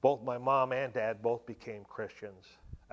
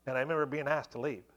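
normal talking voice

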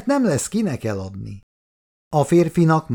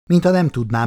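usual speaking voice

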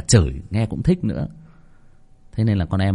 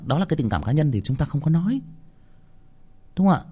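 normal talking voice

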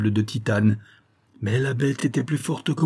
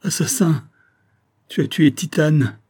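accusation mourante; 5 semitones higher, 3 dB louder than usual. A narrator's reading. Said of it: "dans un dernier spasme".

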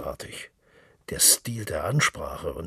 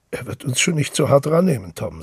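louder, higher, coaxing and charming; winning; 8 semitones higher, 10 dB louder than usual. A narrator's reading. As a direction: win him over with a friendly push. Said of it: "sagte Mister Power gewinnend".

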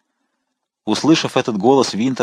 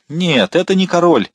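high, forceful denial; exclaiming; 8 semitones higher, 4 dB louder than usual. A narrator's reading. The rider correcting the mistaken Englishmen, exclaiming. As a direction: cry out to correct them. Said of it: "воскликнул всадник".

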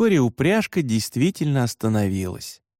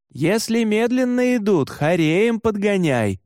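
calling after someone; 8 semitones higher than usual. A narrator's reading. Gavrila instructs new driver from behind, calling out. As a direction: call it out to a departing sled. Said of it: "крикнул мне вслед Гаврила".

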